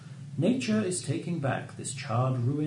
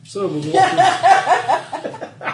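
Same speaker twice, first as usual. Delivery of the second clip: drawn out through laughter; trying to talk while laughing; 11 dB louder, much slower than usual. Real, not acted.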